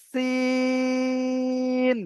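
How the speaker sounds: সিন with সি held long and ন short; long sin. Shown as a demonstration. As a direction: draw out সি then close on ন. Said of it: "সি বলে টানটা করতে হবে". (সি টেনে সিন)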